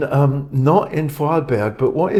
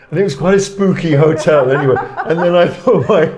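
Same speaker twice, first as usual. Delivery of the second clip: laughing; 5 semitones higher, 7 dB louder than usual. Real, not acted. Laughing through the spooky prank story.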